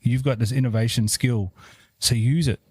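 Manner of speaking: ordinary delivery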